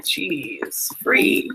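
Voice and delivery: ordinary delivery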